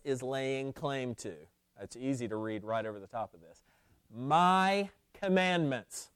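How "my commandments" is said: "My commandments" drawn out slowly, pitched higher; emphatic quotation. Shown as a demonstration. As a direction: stretch out "My", then let "commandments" fall. Preacher stresses Christ's claim in verse.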